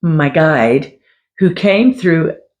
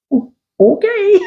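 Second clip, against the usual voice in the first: very high, hesitant okay with a laugh behind it; mock-bewildered squeak of agreement; taken aback. Client reacts to the reader abruptly ending the session, taken aback.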